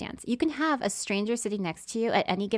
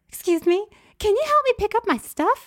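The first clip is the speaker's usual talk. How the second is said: much higher and louder, sugary ask; high sweet request; 13 semitones higher, 9 dB louder than usual. Retold or imitated. Seatmate asking narrator to pick up her purse, high and sweet. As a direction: put on a sweet pleading lady.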